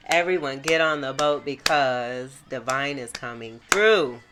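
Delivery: loud, rising excited announcement; excited call. Real, not acted. Reader announcing to her viewers, loud and excited. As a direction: call it out like big news to everyone. Excited tarot reveal, calling out.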